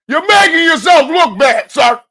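high, loud, slow scolding yell; scolding shout. Real, not acted. Scolding [name] for talking, with an angry shout. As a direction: tell him off at full volume.

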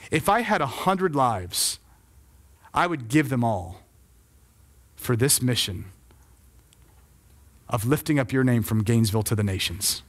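low, measured words of devotion; hushed vow. Retold or imitated. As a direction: let each phrase fall gently after a long pause. Recalling words that slipped out, overwhelmed.

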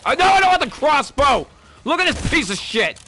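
loud, high frustrated shout; frustrated yell. Real, not acted. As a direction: yell at the game. Frustrated yelling at game.

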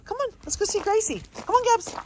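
quick high coaxing. Real, not acted. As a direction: urge her on brightly. Urging the dog along.

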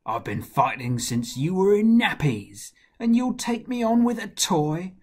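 loud, scornful boast with leaps in pitch; taunting giant. Acted. Goliath mocks the shepherd boy before the fight, loud taunt. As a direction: bellow the opening, then settle into menace.

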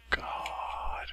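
breathy fed-up whisper; exasperated whisper. Real, not acted. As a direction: whisper it in frustration.

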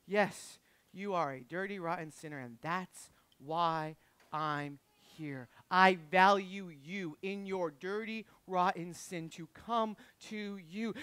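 low, soft, slow and tender; tender reassurance. Acted. Jesus answering a sinner who comes to him, tender. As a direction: speak gently and slowly, giving each word room.

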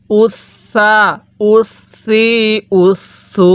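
উস সা, উস সি, উস সু with a soft, long held s, never sh; measured drill, the s softened and held; soft sa sound. Shown as a demonstration. Teacher recapping for learners, soft doubled s in each. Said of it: "সাটা আমাদের নরম করে পড়তে হবে".

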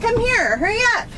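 high, loud call across the room. Real, not acted. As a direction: call her over in a hurry. Calling birthday girl over.

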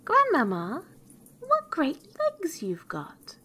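high, slow wonder; amazed little girl. A narrator's reading. Girl marvels at granny in bed, high and amazed. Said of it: "being greatly amazed to see".

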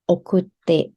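โอคุเตะ said slowly, syllable by syllable; slow model word. Shown as a demonstration. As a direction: stretch out โอคุเตะ so students can copy it. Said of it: "จาก โอคุรุ ก็จะเป็น". (พูด โอคุเตะ ช้าๆ ให้ฟัง)

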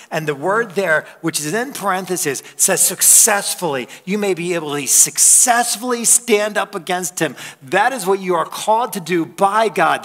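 rally the church against the enemy; fiery preaching. Acted. Preaching rises to full force.

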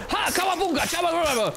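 very high, excited war cry; demonstrated battle cry. Shown as a demonstration. Player showing how to attack, high battle cry. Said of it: "I podbiegasz i robisz".

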